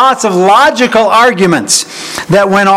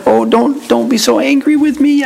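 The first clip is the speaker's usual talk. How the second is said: higher-pitched meek pleading; mock humble plea; 6 semitones higher than usual. Imagined Aaron backing down before Moses, mock-humble. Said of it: "back down on this and humbly said".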